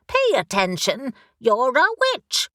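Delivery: brisk, impatient talking raven; a raven who has searched everywhere and is exasperated; bossy raven. Acted. Raven character voice.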